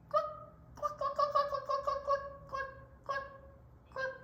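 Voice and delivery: high, short staccato clucks; hen clucking. Acted. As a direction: cluck in a rapid run, then spaced out. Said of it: "Hen said".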